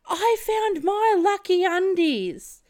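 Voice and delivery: high, loud drawn-out yell; high slow mock yell; yelled quote. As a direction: shout it out like a drunk friend. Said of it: "She yells out".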